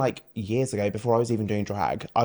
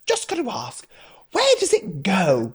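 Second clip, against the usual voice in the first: pitched-up imitation of a prying stranger; nosy questioner; 7 semitones higher, 4 dB louder than usual. People meeting a drag queen ask about tucking, mock nosy questioner. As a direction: put on a higher, over-curious asking voice.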